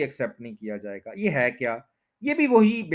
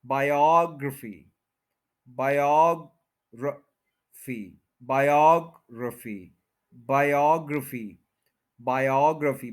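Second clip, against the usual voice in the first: Biography slowly as bai-AWG-ruh-fee; second-syllable stress; slower than usual. Shown as a demonstration. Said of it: "second पे stress है".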